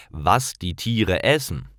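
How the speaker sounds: ordinary talk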